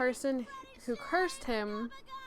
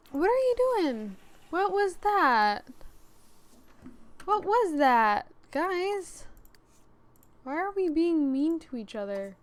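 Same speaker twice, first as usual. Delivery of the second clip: dismayed scolding; 5 semitones higher, 4 dB louder than usual. Real, not acted. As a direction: scold squabbling characters in high-pitched dismay. Scolding game characters for being mean.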